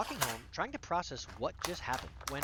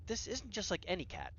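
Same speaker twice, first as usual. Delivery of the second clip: ordinary delivery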